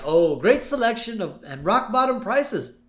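high, slow wistful Apu voice; lilting shopkeeper sigh, slowing down; imitating Apu. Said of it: "Abu's kinda like".

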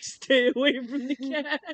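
high, loud, drawn-out plea; mock warning. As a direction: warn them off in mock alarm. Voiced warning at hissing puma.

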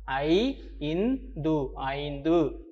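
ஐ, இன், து slowly apart, then ஐந்து whole; clearly sounded out. Shown as a demonstration. Teacher showing children clear pronunciation, syllable by syllable. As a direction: break ஐந்து into ai, in, dhu, then join it. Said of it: "தெளிவா உச்சரிக்கணும்".

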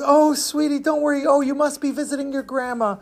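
put-on high, cooing comfort for a child; soothing sympathy. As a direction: console a poor little orphan. Ticket seller comforts supposed orphan.

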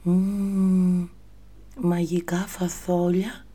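put-on high child voice lisping φαθόλια with th; a wondering little boy, lisping; Jack as a lisping boy. Jack wondering about the stranger's beans, high lisping child voice. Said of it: "σκέφτηκε, παιδιά, ο Τζακ".

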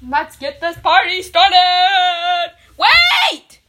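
loud excited shout. Acted. Host calling out to start the party, loud shout. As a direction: call out to the whole party. Party kickoff then halt.

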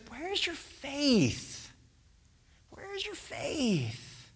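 soft, high, slow questioning; hushed, searching plea; gentle reproach. Acted. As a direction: ask it softly, stretching each word. Jesus gently questioning disciples.

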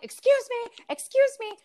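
high, polite excuse me called upward; calling out. Retold or imitated. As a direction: call up to the neighbor to get her attention, pitched high. Calling up to neighbor on balcony.

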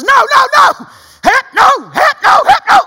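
high, loud, frantic repeated no; panicked shouting. Retold or imitated. Man swinging on the trapeze refusing the call, frantic high yelling.

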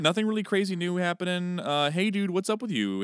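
ordinary talk

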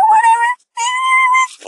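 squeaky, drawn-out high note; very high falsetto. Shown as a demonstration. Proving to viewers she keeps her range, squeaky high. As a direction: stretch it way up slowly. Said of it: "I can still go".

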